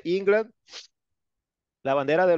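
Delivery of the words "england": "England" said in English, ING-gland, higher, ending on a rise; teacher reminds class how to say "England"; English pronunciation. Shown as a demonstration. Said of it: "Acuérdense".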